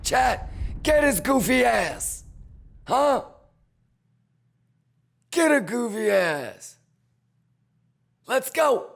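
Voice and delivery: high, loud cheering on; hyped cheering. Real, not acted. Streamer urging chat to get the goofy seller, loud and high. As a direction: egg chat on to get him.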